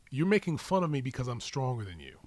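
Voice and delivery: ordinary talk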